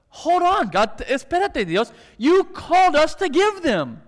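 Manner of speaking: high, loud, exasperated pleading; indignant protest to God. Acted. Preacher voicing a believer arguing back to God, high and indignant. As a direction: bristle like a wronged believer talking back.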